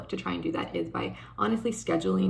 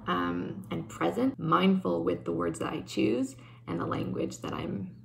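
usual speaking voice

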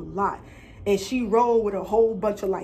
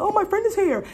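mock sweet; 11 semitones higher, 4 dB louder than usual. Mocking the suggested excuse.

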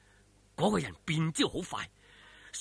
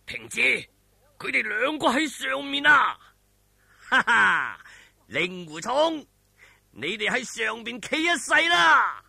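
villain gloating shout; 4 semitones higher, 6 dB louder than usual. A narrator's reading. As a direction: crow over the trapped heroes, loud and slow at the end. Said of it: "咁左冷禪就講啦".

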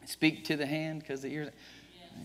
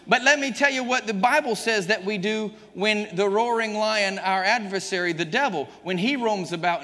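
loud, higher fiery preaching; full force preaching; 7 semitones higher, 6 dB louder than usual. Acted. Preacher exhorting the congregation at full force. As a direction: thunder the verses at the church.

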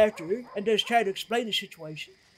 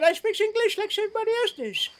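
indignant retort; 10 semitones higher than usual. Retold or imitated. Retold indignant reply to stranger.

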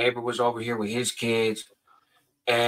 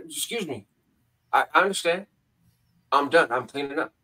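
ordinary delivery

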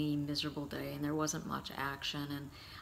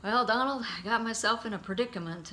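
louder, higher sheepish admission; Dad admitting he's stuck; 5 semitones higher, 5 dB louder than usual. Retold or imitated. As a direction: confess it with a grin. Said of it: "he's like".

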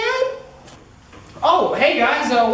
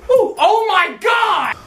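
higher, louder, slow startled yell; startled shout; 9 semitones higher, 4 dB louder, slower than usual. Acted. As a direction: jump at being walked in on.

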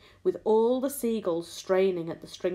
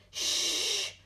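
long voiceless hiss; whispered shush; 4 dB quieter than usual. A narrator's reading. James silencing the earthworm, whispered shush. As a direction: hush with a drawn-out shh. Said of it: "whispered James".

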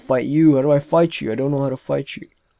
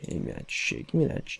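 whisper; 10 semitones lower, 9 dB quieter than usual. Real, not acted. Grabbing wood.

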